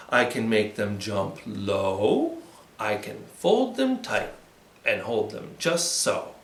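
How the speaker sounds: put-on soft rhythmic chant for kids; hushed playful rhyme; finger play rhyme. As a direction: chant the rhyme gently to little ones.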